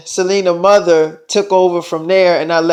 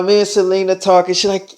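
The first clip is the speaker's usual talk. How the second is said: ordinary delivery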